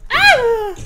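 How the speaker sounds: short high loud scream; real frightened shriek; startled scream. Real, not acted. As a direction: scream at the sudden scare. Jump scare.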